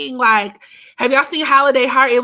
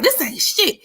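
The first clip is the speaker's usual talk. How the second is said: higher, dismissive quote; unimpressed friend; 6 semitones higher than usual. Retold or imitated. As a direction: brush it off, bored. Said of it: "She was like".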